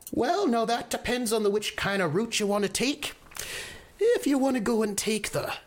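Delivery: put-on high, chatty elderly woman giving directions; a rambling old lady in her eighties, pitched high; old village woman. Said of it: "an older woman in the corner ... maybe in her eighties".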